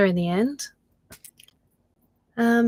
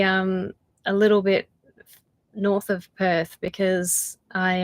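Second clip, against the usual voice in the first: ordinary talk, nothing marked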